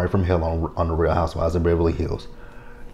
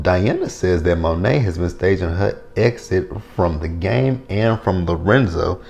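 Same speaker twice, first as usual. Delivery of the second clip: ordinary talk, nothing marked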